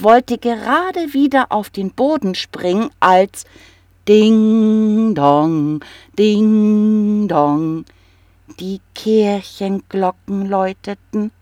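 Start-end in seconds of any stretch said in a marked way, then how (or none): 4.1-5.8 sung bell tone, held high then falling; bell chime. Acted. Narrator rings the church bells in the story, as a high chime. 6.2-7.8 second bell chime in another tone; bell echo. Acted. Narrator rings the church bells again, as an echoing chime.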